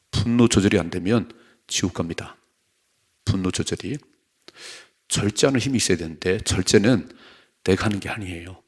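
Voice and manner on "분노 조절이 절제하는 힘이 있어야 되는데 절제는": ordinary talk, nothing marked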